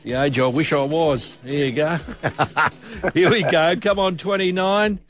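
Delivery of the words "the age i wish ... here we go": normal talking voice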